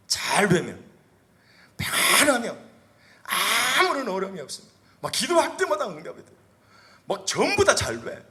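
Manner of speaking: high, loud, fervent sermon climb; preaching at full force. Acted. Pastor exhorts the congregation, fervent loud preaching.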